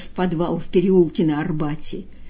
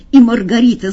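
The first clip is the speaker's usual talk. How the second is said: plain reading voice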